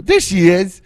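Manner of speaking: loud, bright call to a dog; excited calling the dog. Real, not acted. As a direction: call the puppy over, eager.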